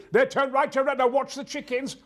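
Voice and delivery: high, loud, frantic directions; excitable over-the-top navigator; ridiculous sat nav. Pretend. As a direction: cry out absurd warnings. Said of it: "things in that are ridiculous".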